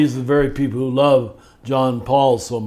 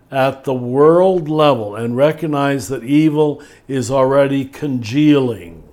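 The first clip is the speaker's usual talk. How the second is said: ordinary talk, nothing marked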